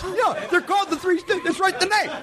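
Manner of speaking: high pitched, laughing through the words; laughing disbelief. Real, not acted. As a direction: laugh while correcting your friend. Repeats the joke, laughing.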